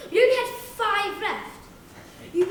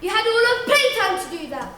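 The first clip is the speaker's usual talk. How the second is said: loud, high scolding refusal; strict teacher; 5 semitones higher, 7 dB louder than usual. Acted. Teacher refusing the whiny kid, loud stern scolding. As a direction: shout the pupil down sternly. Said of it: "And she'd say".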